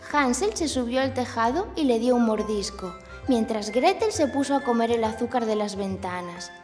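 ordinary delivery, nothing marked

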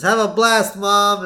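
put-on high, loud little-girl cheer; Lisa's voice. Lisa wishing her mom a fun night, high and loud. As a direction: chirp it like a bright kid. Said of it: "Lisa says".

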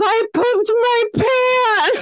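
high, drawn-out mock wail; imitated crying child. Five-year-old confessing to aunt at the door, mock crying. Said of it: "she starts crying and she goes".

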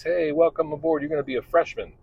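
high, bright-eyed welcome wagon voice; mock-cheery welcome to a new freshman; chirpy greeter. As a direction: play the eager school greeter.

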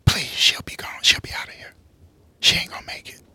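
unvoiced, sly whisper. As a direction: hush it, knowing. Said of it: "like, whispered it".

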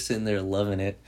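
ordinary talk

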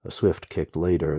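plain reading voice

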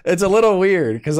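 high, loud, laughing through the words; amused laughing. Real, not acted. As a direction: crack up while trying to explain. Cracks up at his own remark.

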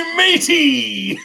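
loud, high, slow pirate cry; pirate shout. Acted. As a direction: belt it out like a pirate captain. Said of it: "put your whole body into it".

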